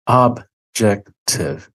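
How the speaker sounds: OBJECTIVE broken slowly into separate syllables; slow, measured repeat of OBJECTIVE; deliberate emphasis. Real, not acted. Repeats key word for emphasis.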